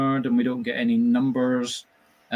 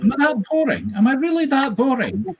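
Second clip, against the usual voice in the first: high, mock hurt question; mock offended; 3 dB louder than usual. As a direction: pretend to be insulted.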